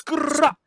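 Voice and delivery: high, loud growled gun call; dancehall gun sound. Retold or imitated. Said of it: "The thing goes".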